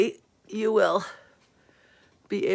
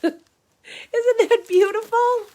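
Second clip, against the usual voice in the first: 1 laughing gush; 13 semitones higher, 5 dB louder, much slower than usual. Real, not acted.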